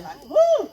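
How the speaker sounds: high, loud imitated whoop; stomp dance holler. Retelling the call from the woods, a loud high whoop. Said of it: "guys that hollered like that".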